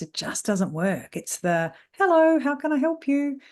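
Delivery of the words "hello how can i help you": high, loud, fake service voice; mock receptionist singsong, pitched up; stock customer greeting. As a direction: greet a client with put-on pep. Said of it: "It's this standard stock level of behavior".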